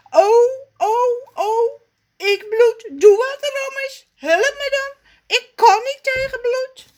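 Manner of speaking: very high, loud panic; panicking. Acted. Raf panics at his blood, pleading with Rommes, very high. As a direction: beg for help, frightened.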